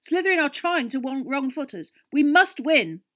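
high, loud, frantic team rant; rally them with fanatical urgency; shouting. A narrator's reading. Captain urging his team not to relax, shouting. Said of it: "Wood shouted, his eyes bulging slightly".

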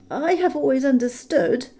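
high, timid hobbit squeak; frightened squeak. A narrator's reading. Bilbo probing the dragon, frightened squeak. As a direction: squeak it out nervously. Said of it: "in a frightened squeak".

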